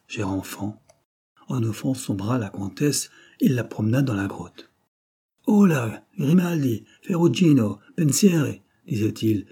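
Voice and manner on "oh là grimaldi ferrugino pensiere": calling out. Acted. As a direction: call them over, raised and high. Bandit chief calls his men over.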